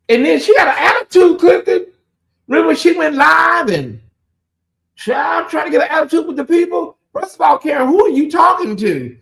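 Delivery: high, loud, laughing call-out; fired-up and half laughing, calling her out; heated rant. Real, not acted. Host calling out Karen on her live, excited shout.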